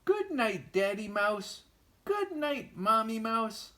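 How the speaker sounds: put-on very high, tiny baby voice; baby mouse voice.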